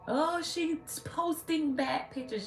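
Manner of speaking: high, slow mocking voice; high scandalized mock of the critics. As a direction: drawl it out like the prissy critics. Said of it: "y'all done went crazy".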